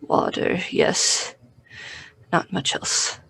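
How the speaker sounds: barely voiced, weak and parched; hoarse whisper. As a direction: rasp it out like a starving captive. Starved prisoner answering.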